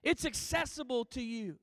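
ordinary delivery

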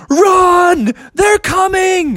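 high, loud, urgent cry; storybook bear raising the alarm; warning shout. Acted. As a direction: yell a high warning to the others. Bear warns the others.

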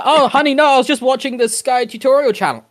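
high, caught-out excuse to honey; squeaky guilty husband voice; sheepish excuse. Pretend. Imagined excuse to partner.

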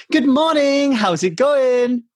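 high, slow sing-song greeting; an imagined sunny hello, drawn out high; bright greeting. Retold or imitated. What wife did not say.

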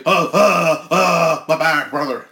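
high, loud pained Hogan-style groan; hurt wrestler groan. Acted. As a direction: moan like a wrestler in agony.

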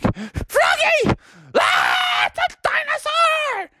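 very high hammed-up scream; shrieking mom. Mom warns Froggy of dinosaur, shrieking in panic. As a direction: scream the alarm in a piercingly high voice. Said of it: "his mom was like".

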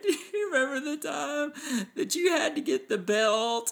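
put-on high, slow little-girl voice; high, slow innocent-child voice; imitating little daughter. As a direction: ask it sweetly like a small child. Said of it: "And she's like".

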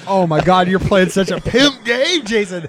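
loud, high, amazed praise; excited hype. Real, not acted. Player praising teammate's pimp game, excited and loud. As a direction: gush loudly over a teammate's play. Hypes teammate's great play.

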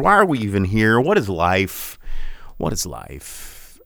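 usual speaking voice

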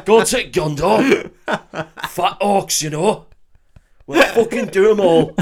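high, laughing mock Sheffield; Boromir's Sheffield accent. Boromir wanting pie and gravy, hammed-up Sheffield accent. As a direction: ham up a loud Sheffield accent. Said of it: "Little, little Sheffield accent".

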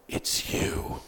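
voiceless, stunned whisper; whispered realization. Acted. Goblin recognizing who he faces, whispered realization. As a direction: let it out as an acted whisper.

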